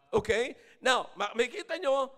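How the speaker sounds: ordinary talk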